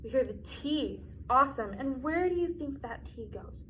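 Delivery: bright, sing-song kindergarten teacher. Acted. Teacher guiding young children through a spelling lesson, sing-song. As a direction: lead little kids through spelling.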